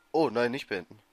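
usual speaking voice

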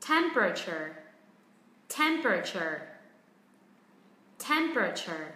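TEM-pruh-cher, TEM clear, the rest short and dropping; first-syllable stress. Shown as a demonstration. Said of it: "nhanh hơn và xuống giọng".

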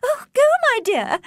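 a flustered mother eager to please her ladyship; eager fussy mother. A narrator's reading. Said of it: "cried Missus Bennet".

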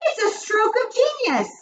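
very high, gushing witch voice; high-pitched thrilled exclamation; delighted admiration. A narrator's reading. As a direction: gush like an adoring follower. Witches marvel at the idea.

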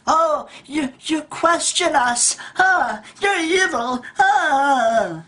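high-pitched scandalized gasping; mock outrage. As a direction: imitate someone horrified at being questioned. Mocks society's outrage at being questioned.

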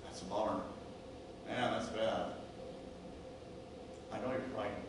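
quiet, unhurried comfort; calm reassurance. Acted. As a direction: gently soothe someone who is hurt and frightened. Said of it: "he speaks to you slowly and calmly".

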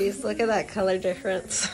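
lips held apart, teeth bared; talking with cheek retractor. Real, not acted. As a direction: mouth stretched open by retractor. Seen 0: Lip retractor stretches her mouth wide open through the labelled words.

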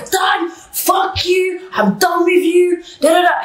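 high furious yell, breaking it off; screaming. Retold or imitated. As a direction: scream it down the phone. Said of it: "I was screaming".

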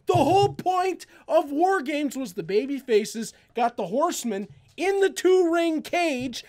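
ordinary talk

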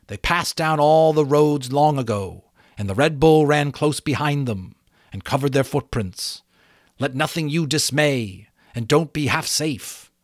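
butterfly warning of the Red Bull. A narrator's reading. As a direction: warn her gravely.

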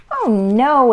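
dismayed cry. A narrator's reading. Ava learns story time was canceled, dismayed cry. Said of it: "Ava cried".

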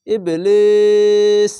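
stopping form ইবলিস, long held ee, slow; pausal recitation. Shown as a demonstration. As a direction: stretch ইবলিস with a three-count pull when stopping. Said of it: "আর যদি থামেন".

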